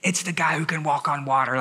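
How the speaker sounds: whispered aside, as if letting them in on a secret; stage whisper. Pretend. Pastor whispers the obvious answer to the congregation, stage whisper.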